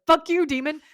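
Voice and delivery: high, loud, defiant outburst; mock angry yell. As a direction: shout it like a dad defending his family. Acts father attacking the demon.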